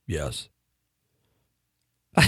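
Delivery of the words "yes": much lower, quiet, one-word deadpan; deadpan baritone. Retold or imitated. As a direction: say it low with no expression. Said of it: "he had this baritone voice".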